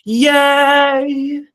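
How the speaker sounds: high, loud, drawn-out cheer. Pretend. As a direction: let out a long high-pitched hooray. Cheers at the vowel system result.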